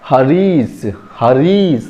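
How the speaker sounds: हरीश with a lingering, stretched ee in री; long ee demo. Shown as a demonstration. Teacher showing the long vowel in हरीश, री held long. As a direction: draw out the री in हरीश. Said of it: "री में थोड़ा समय लग रहा है".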